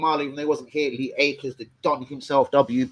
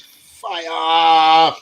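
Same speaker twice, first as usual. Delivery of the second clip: hype shout; 4 semitones higher, 11 dB louder than usual. Real, not acted. Hyping his stream.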